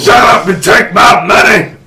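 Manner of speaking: loud, put-on eager yell; hammed-up impatient buyer's shout; mock demanding shout. Fan demands tickets to the dream double bill, mock shout.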